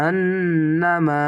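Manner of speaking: আন্নামা slowly, the নন drawn through the nose; nasal ghunnah. Shown as a demonstration. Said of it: "এখানে ওয়াজিব গুণনা".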